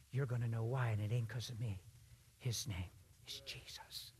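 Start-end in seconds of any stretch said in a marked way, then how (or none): 2.4-4.1 slow, hushed whisper; reverent whisper. Real, not acted. Preacher quietly naming Jesus to the crowd, whispered. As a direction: breathe the name slowly in a hush.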